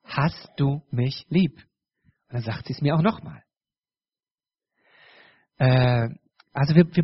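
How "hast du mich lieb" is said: very slow, word by word; insistent repeat question. Acted. Husband asking his wife again for reassurance, slow and insistent. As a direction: ask it once more, spacing out every word. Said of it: "ich frag's jetzt nochmal".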